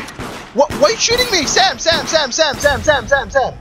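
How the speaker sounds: high-pitched alarmed calling out; panicked, calling his friend's name; alarmed shouting. Real, not acted. Shot at by teammate, calling out.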